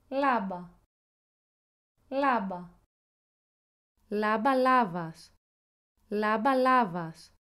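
each word said twice, crisp and even, falling at the end; clear word drill. Shown as a demonstration. Language app voice reading a word list for the learner to repeat. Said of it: "Ακούγεται δύο φορές, ώστε να την επαναλάβεις".

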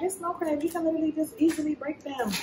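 high, soft, fast voice of a know-it-all viewer; mock viewer voice. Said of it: "y'all might be like".

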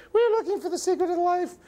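falsetto puppet voice; high cartoon sidekick. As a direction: speak up in a tiny, very high register. Characters address the magic crystal.

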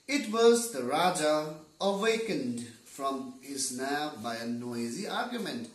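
usual speaking voice

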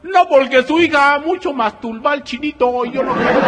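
very high, loud put-on voice; mock Chinese voice. Chinese suitor answers the father, very high and loud. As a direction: explain it innocently in the put-on voice.

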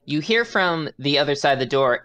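ordinary talk

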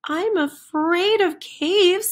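high, worried chicken voice; a timid chicken owning up, pitched high; scared chicken. Acted. Chicken admits to friends it fears caves, high and timid.

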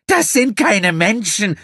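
high, loud indignant outburst; protesting. A narrator's reading. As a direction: flare up in defiance. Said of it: "begehrte Gina auf".

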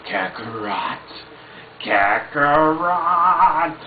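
Broly impression. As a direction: bellow the name like Broly. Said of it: "Broly from Dragon Ball Z".

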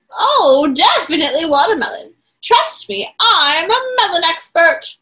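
loud confident toucan voice; big beaked toucan. Acted. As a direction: boast like a melon expert. Toucan insists.

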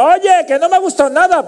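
high mock complaining voice; disgruntled spectator. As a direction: complain in a put-on high pitch. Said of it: "A veces hay alguno".

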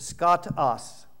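skatos said very slowly, syllable by syllable; careful Greek word. Shown as a demonstration. Preacher teaching the Greek word for darkness, careful. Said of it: "if I said it right".

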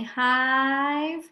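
"hive" stretched out; slow. Shown as a demonstration. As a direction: hold the long I in "hive". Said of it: "the reason we say it slowly".

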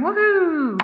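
high, loud little cheer. Real, not acted. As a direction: whoop with delight at getting it right. Celebrates getting it right.

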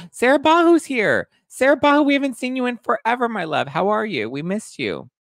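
very high, loud delighted welcome; excited greeting. Real, not acted. Host excitedly welcoming Sarah Bahu to the live chat.

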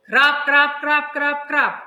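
loud, pounding "крап, крап"; heavy rain sounds. Shown as a demonstration. Teacher showing strong rain for children to name. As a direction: hammer out a heavy downpour. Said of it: "це сильний дощ".

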